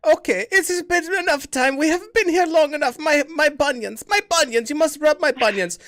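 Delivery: high, loud creaky granny; hammed-up old granny asking for her purse; old lady voice. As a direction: play the dotty old grandmother begging for her purse. Old grandma character again.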